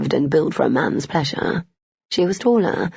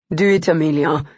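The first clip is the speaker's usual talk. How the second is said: ordering. A narrator's reading. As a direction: order her to do it. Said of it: "he ordered".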